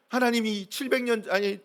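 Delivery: ordinary talk, nothing marked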